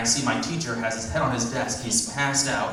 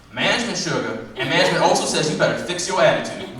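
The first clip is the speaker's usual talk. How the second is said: sassy clerk; 5 semitones higher, 5 dB louder than usual. Acted.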